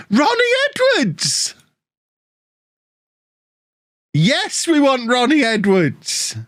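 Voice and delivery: very high, slow drawn-out hype; excited. Real, not acted. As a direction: chant the name like a fan. Hyped about signing a player.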